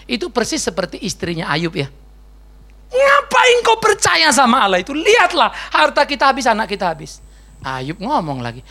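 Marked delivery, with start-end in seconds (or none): 2.9-7.2 angry wife. Acted. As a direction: shout at him like a furious wife. Istri Ayub marah memaki. (istri yang marah membentak)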